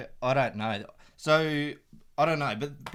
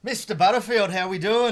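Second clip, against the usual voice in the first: higher, louder, pumped-up hello; loud excited greeting; 9 semitones higher, 8 dB louder than usual. Real, not acted. Guest arriving on the show, loud high excited greeting. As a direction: burst in with big energy.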